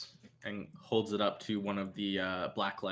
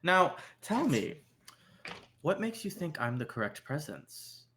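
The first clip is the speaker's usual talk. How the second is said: probing club owner; 7 semitones higher than usual. Acted. Club owner asks the bold visitor about presence, probing. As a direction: put the challenge to the visitor, pitched up. Game master plays Yuri.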